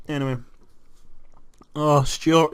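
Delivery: ordinary talk, nothing marked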